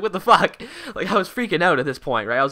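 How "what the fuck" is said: shocked exclamation. Retold or imitated. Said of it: "I was like".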